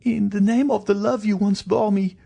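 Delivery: high, wailing entreaty; frantic high-pitched begging; desperate plea. Acted. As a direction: implore in a very high woman's voice. Wife begs for her life.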